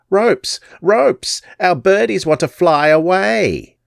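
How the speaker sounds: put-on very high shrieking old hag; shrieking crone. Crone shrieking to Zelda for ropes. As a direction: shriek the orders out. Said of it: "raised her voice".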